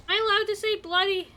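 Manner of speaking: quick, high and playful; mischievous question; cheeky. Real, not acted. Cheeky question about swearing.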